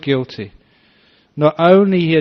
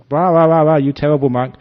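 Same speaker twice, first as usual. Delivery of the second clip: mock scolding; 2 semitones higher, 5 dB louder than usual. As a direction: scold him like an outraged villager. Angry villagers harangue the monk.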